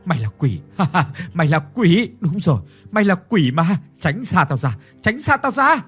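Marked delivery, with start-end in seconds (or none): 1.3-3.8 hysterical accusation. Acted. 5.0-5.8 shrieking it much higher and louder; panicked high scream to keep the demon away; frantic shriek. Acted. Pushing the maid away.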